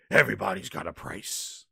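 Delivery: low, soft, raspy villain voice; sinister gloating undertone; Million Dollar Man. Acted. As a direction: drop it low like a wrestling heel. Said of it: "like the Million Dollar Man".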